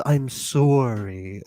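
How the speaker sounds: drawn-out, studied actor's apology; Al Pacino. Retold or imitated. Studying actors saying sorry on screen, slow drawn-out impression. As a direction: stretch "I'm sorry" slowly like a screen actor. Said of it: "Al Pacino's".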